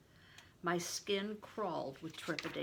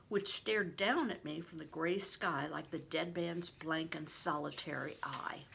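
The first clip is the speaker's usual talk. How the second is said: usual speaking voice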